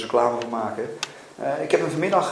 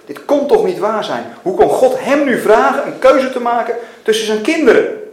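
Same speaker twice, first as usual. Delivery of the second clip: loud, high-rising incredulous protest; frantic disbelief; 7 semitones higher, 11 dB louder than usual. A narrator's reading. Mackenzie asked to choose between his children, frantic disbelief. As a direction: protest as if reeling. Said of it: "Zijn hoofd sloeg op hol".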